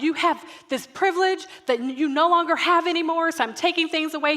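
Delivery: loud, high parental scolding; retold yelling. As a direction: re-enact a parent yelling out punishments. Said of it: "I'm yelling".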